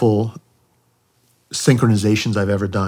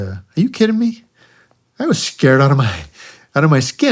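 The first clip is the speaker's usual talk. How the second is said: mock disbelief; 5 semitones higher, 3 dB louder than usual. Reply to the crew chief.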